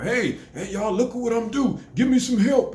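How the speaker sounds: high, loud clout-chaser voice; mock a show-off filming himself; mock social media hype. Mocking clout chasers on camera, high hype voice. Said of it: "running around with a camera".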